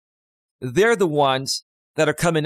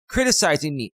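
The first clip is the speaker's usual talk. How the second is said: usual speaking voice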